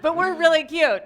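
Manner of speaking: loud, high, laughing through the words. Real, not acted. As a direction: joke while cracking up. Partner jokes about wrong slide.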